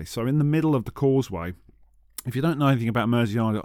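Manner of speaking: ordinary talk, nothing marked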